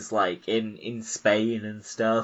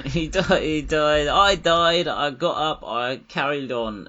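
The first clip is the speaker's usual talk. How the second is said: higher, hammed-up stoic soldiering on; higher, louder mock of a stoic elder; mock stiff upper lip; 8 semitones higher, 5 dB louder than usual. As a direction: play a proud, unbothered old Brit. Mocking stoic old Brit.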